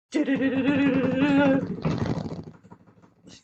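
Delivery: loud trilled drum-roll imitation. Host building suspense before the giveaway draw, mimicked drum roll. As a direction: roll the tongue loudly like a drum. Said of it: "And so drum roll".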